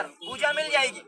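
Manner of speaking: ordinary talk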